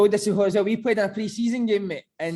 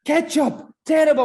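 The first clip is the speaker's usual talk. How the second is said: much higher and louder, spitting out Ketchup; disgusted rant; 8 semitones higher, 6 dB louder, slower than usual. Retold or imitated. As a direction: scorn the sauce like a furious coach. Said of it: "he's like".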